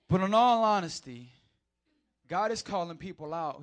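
loud, high fiery preaching; full-throttle preacher; preaching at full force. Acted. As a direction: thunder it from the pulpit. Preaching surge.

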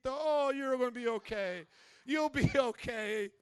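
loud, put-on reassurance; hammed-up soothing; mock comforting.

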